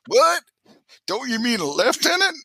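very high, squeaky disbelief; shriek-high incredulous correction, hammed up; mock astonishment. Mimics shocked locals correcting him.

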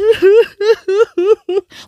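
high, loud hammed-up boo-hoo sob; mock wailing sob. Shown as a demonstration. Vlogger imitates the drama mom's boo-hoo cry, mock wail. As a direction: wail like a bad drama crier. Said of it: "cried like this".

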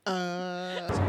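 low, drawn-out clueless "uh"; mock dumbstruck. Recapper mimics Brent stuck for an answer, mock dumbstruck. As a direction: stall blankly, as if struck mute. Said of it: "Are you deaf, dumb, and mute?".

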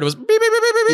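high mock radar beeps; imitated beeping. Mimicking the radar detector to his friend, high beeps. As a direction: beep it like a detector going off. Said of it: "my radar detector started going off".

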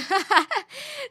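a burst of loud, high laughter; loud laugh at the memory of the creepy offer. Real, not acted. Laugh after refusing the ride.

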